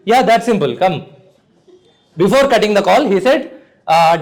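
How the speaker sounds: normal talking voice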